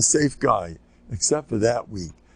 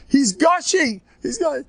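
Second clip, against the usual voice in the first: put-on high, loud child voice, overdramatic; little boy exaggerating a scrape; dramatic kid; 4 dB louder than usual. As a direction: play up the wound like an excited kid.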